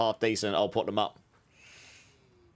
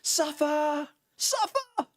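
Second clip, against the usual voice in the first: high-pitched taunt; much slower than usual. Retold or imitated. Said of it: "others of you in the chat that are like".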